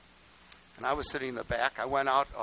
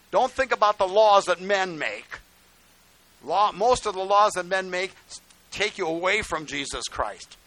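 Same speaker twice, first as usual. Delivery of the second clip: loud, emphatic warning; forceful preaching; 4 semitones higher, 8 dB louder than usual. Real, not acted. Pastor warning congregation against men's laws, loud and emphatic. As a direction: preach it hard to the crowd.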